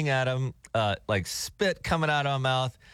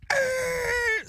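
high mock angry growl; hammed-up rage growl; angry roar. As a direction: roar like a furious driver. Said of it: "this guy is like".

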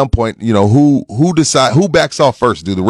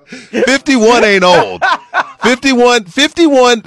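high laughing protest; 11 semitones higher, much slower than usual. Real, not acted. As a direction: laugh it out, pitched way up. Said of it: "I'm laughing and I'm smiling when I say this".

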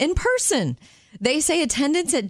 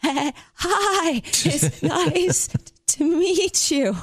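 high, slow, shivering greeting; 7 semitones higher, much slower than usual. Pretend. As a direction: greet them with teeth chattering from the cold. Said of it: "You'd be so cold".

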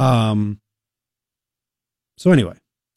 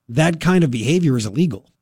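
usual speaking voice

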